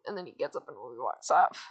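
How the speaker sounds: ordinary delivery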